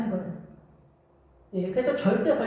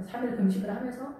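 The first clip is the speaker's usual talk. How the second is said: normal talking voice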